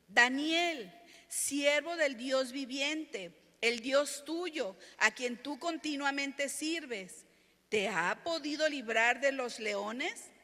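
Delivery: loud, slow, drawn-out call. Acted. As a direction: cry out the king's words to Daniel. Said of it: "llamó a voces a Daniel con voz triste".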